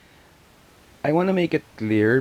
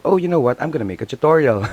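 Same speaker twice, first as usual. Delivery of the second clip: excited; 7 semitones higher, 8 dB louder, faster than usual. Retold or imitated. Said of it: "she thinks to herself".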